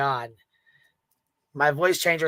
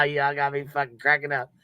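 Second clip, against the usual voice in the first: ordinary talk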